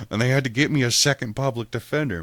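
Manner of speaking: ordinary delivery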